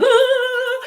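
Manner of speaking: high shrill mock machine shriek; washing machine wail. Washing machine eating the shirt, squealed high. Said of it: "My washing machine is like".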